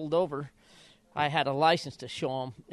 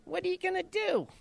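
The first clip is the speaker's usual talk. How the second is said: much higher imitation of the trainer's squeaky voice; high-pitched chief. Jockey mimicking his trainer before the race, much higher squeaky voice. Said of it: "he had that kinda high-pitched voice".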